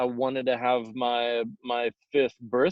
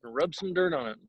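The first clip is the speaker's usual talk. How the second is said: careless parent voice; 5 semitones higher than usual. Retold or imitated. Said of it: "our parents were just like".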